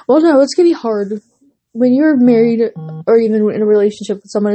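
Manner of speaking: ordinary delivery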